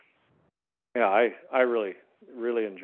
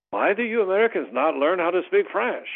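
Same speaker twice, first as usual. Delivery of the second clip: imitating an indignant French local, higher and louder; mock French complaint; 8 semitones higher, 6 dB louder than usual. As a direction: play the offended French host. French locals questioning him.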